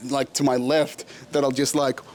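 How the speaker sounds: ordinary delivery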